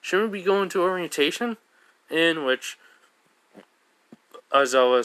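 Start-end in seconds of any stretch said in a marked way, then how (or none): 0.0-1.6 higher, hesitant schoolgirl question; timid higher student voice. Retold or imitated. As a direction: raise the pitch, a shy objection. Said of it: "raises her hand and goes like".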